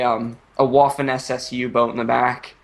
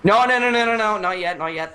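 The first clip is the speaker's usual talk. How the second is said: excited protest; 8 semitones higher than usual. Real, not acted. Protests friend killing off parrot.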